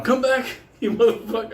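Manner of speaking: high, loud laughing taunt; mock taunt. To the limping woman, loud laughing taunt. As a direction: yell it after her, cackling.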